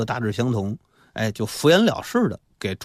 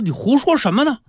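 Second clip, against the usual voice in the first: high-pitched acted rebuttal; indignant retort; 13 semitones higher, 3 dB louder than usual. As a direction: fire back in a much higher voice. Indignant retort in acted story dialogue.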